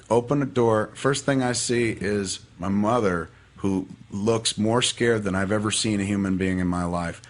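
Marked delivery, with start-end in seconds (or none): none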